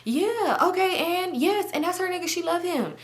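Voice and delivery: high, dismissive imitation of her friend; lightly mocking high friend voice. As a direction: mimic her rattling it off. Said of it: "And she like".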